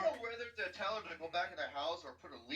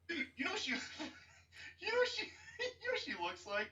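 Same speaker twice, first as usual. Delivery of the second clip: laughing; 12 semitones higher than usual. Real, not acted. Cracking up mid-joke.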